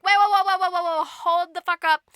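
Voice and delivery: loud string of whoas; frantic interruption. Acted. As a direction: burst in to halt everything. Servants interrupt the raging king.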